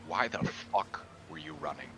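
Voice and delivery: ordinary delivery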